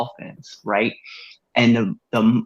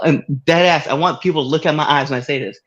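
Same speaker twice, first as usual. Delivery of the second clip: ordinary talk, nothing marked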